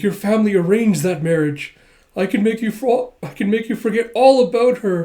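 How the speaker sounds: hammed-up passionate persuasion; soap opera drama. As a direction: plead like a soap opera temptress. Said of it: "a little soap opera drama".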